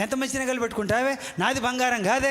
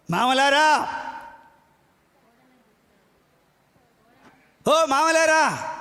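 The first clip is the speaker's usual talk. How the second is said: calling out; 4 dB louder, much slower than usual. Acted. Preacher calling out to fathers-in-law, slow loud appeal. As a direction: stretch out the call to the fathers-in-law.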